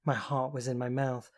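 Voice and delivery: plain reading voice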